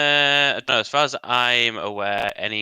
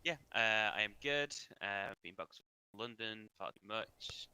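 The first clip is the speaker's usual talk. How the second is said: very faint, level turned right down; too quiet; 12 dB quieter than usual. Real, not acted. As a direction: speak as if the mic gain dropped away. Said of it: "you've gone down twenty D B".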